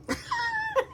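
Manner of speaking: a high, bright cackle; high burst of laughter. Real, not acted. Imagining Dex's reply, high laughing burst. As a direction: laugh out at your own joke.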